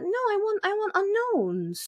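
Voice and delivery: high, drawn-out plea; mock protest. Fan objecting to big names in the cast, high mock protest. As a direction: pitch it high and stretch the last word. Acting own protest at casting.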